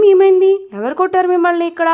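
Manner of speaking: startled when she sees the handprint; alarmed shock. Acted. Supriya sees slap marks, alarmed.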